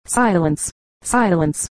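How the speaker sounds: mildly furious command, each cry falling; understated fury. A narrator's reading. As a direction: demand silence with contained anger.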